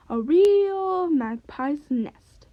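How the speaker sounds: proudly. Acted. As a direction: savour every word with pride. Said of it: "calls the magpie proudly".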